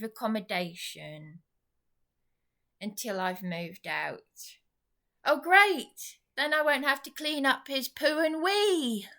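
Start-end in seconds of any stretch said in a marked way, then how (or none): 5.2-9.1 sarcastic. Real, not acted. Sarcastic relief at tenant leaving.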